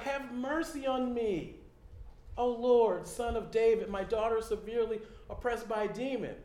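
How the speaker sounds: loud, high begging plea; desperate mother crying out to Jesus; pleading cry. Retold or imitated. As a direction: cry out for mercy. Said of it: "she's crying out to Jesus".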